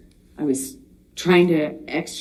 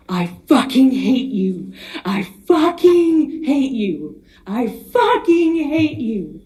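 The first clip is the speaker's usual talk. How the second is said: retold yell, loud from the start, then much higher and slower, desperate and near breaking; retold desperate screaming; 6 semitones higher, 4 dB louder, slower than usual. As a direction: relive his screaming, each time more desperate. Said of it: "Each time it sounded louder and more desperate".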